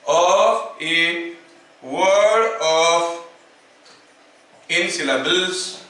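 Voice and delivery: word by word, long pauses between phrases; slow dictation. Shown as a demonstration. Teacher dictating the conclusion to students, slow dictation. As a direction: dictate slowly for note-taking, drawing words out.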